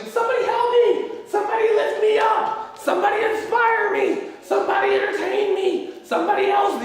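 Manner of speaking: shrill mock cry for help building to a shout; mock needy wail. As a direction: ham up a helpless person begging everyone for rescue. Mocking a needy person crying out.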